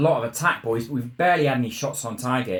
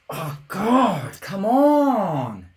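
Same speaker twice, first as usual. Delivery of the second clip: anguished plea; 9 semitones higher, 5 dB louder, much slower than usual. Real, not acted. Despairing at conceding a goal.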